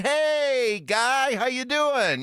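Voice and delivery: imitating a buddy's big high hello; cheerful greeting. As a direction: greet him like an old pal. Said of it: "He's like".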